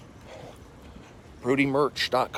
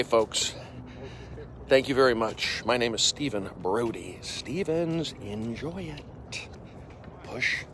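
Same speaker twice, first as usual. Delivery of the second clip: ordinary delivery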